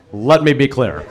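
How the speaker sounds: low, measured Obama cadence; lightly voiced Obama sighing his line; Obama impression. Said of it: "It's like".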